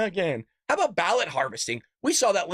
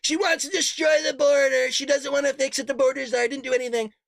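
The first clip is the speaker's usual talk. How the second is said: mock Trump; 6 semitones higher than usual. Mocking Trump on the debate stage, higher put-on voice. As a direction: ham up Trump blasting her on the border.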